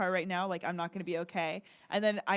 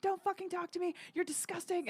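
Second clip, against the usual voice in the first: high, squeezed imitation of panicking; panicked retelling; 10 semitones higher, 3 dB quieter than usual. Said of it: "just started crying. It's like I went into, like, panic mode".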